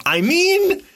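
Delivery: high, put-on voice with a sly edge; mock sarcastic reply. Answering Melinda about the lazy coworker, high and sly. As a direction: squeak it like a cheeky confession. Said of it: "And I was like".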